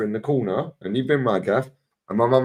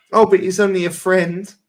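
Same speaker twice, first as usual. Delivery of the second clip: mocking girlfriend; 6 semitones higher, 4 dB louder than usual. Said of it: "mocking women's excuses".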